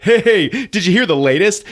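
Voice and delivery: high, lightly hammed-up excitement; mock excited. Student tells the huddle some news, mock excitement. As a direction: imitate someone bursting with gossip.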